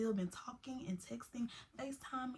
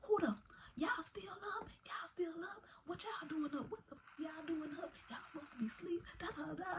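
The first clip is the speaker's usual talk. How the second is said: put-on high, hushed scolding of the kids; a stern auntie catching them awake, hissed; older woman scolding; 8 semitones higher, 6 dB quieter than usual. Auntie catching teens awake at night, hushed high scolding. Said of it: "It was like".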